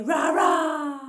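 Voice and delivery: playful drawn-out sung roar; lion roar. Pretend. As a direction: roar loudly like a friendly lion. Sung animal sounds for toddlers.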